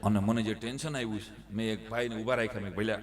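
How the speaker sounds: ordinary delivery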